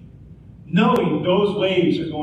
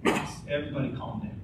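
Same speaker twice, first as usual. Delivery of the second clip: calm reassurance; 4 semitones higher, 5 dB quieter than usual. Retold or imitated. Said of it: "his response is just".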